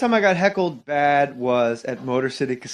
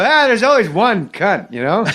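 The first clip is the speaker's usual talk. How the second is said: rambling comic impression, stretched words; Norm Macdonald; 5 semitones higher, 5 dB louder than usual. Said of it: "I did it in the impression".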